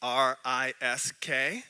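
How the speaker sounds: slowly spelled letter by letter, R I S K. Shown as a demonstration. Preacher spelling R I S K slowly for the congregation. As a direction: spell out R I S K, each letter slow and separate. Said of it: "how he spells faith".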